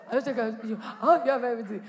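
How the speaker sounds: slow, stretched, laughing check-in; drawn-out greeting. Retold or imitated. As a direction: drag the greeting out with a chuckle. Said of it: "So perhaps you get the message".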